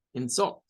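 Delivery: quick "insult", stressed in-SULT; stress on second syllable. Shown as a demonstration. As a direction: say "insult" as a verb, weight on "-sult". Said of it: "la sílaba tónica es la segunda".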